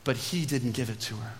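low, soft aside; hushed first take. Shown as a demonstration. Preacher telling of mercy for the guilty woman, hushed. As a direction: drop low and soften the whole phrase. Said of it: "Let me say that again".

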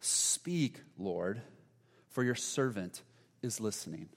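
soft, slow prayer with long pauses; a quiet, surrendered prayer; hushed prayer. Shown as a demonstration. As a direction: pray each phrase gently, letting it hang. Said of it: "are you saying".

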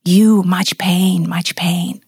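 slow, breathy-free hushed masseur; imitated whisper. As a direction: hush it like a knowing healer. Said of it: "He's like".

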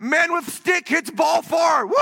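slow, high-pitched caveman cheer; hammed-up crowd shouting; mock fan cheering. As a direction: whoop it like a simple-minded fan. Mocking a cheering baseball crowd.

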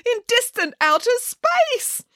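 very high, wailing alarm; panicked yelping. A narrator's reading. Boris fears being turned into a star, panicked yelping. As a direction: wail it in a high, frightened bear voice. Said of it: "yelped Boris".

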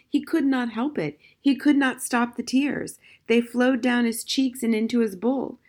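ordinary delivery

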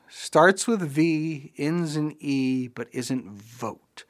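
slow, drawn-out riddle recital; mock-pondering, stretched words; puzzling it out. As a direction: mull over each clue slowly, fading away. Repeats clip's riddle, puzzling it out.

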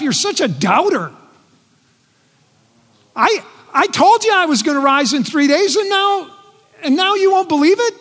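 a hammed-up exasperated rebuke; mock scolding. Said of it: "He doesn't start by looking at Thomas and go".

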